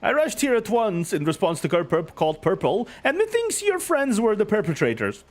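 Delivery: high, booming, grandly cheerful hero; jovial knight voice. Acted. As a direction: proclaim it like a beaming showman.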